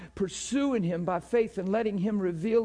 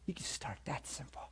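whisper; 4 semitones lower, 15 dB quieter than usual. Real, not acted. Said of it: "whisper heard".

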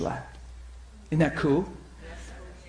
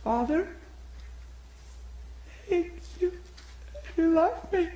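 high, halting voice of a praying woman; tiny high voice, feeling out each phrase; imitating woman's prayer; 6 dB quieter than usual. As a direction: pray it high and tentative, with long pauses. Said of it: "She goes like this".

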